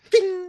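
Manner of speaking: high ringing "ding" sound effect; thin, high ricochet ding; imitated ping. Imitating a glancing hit's ping.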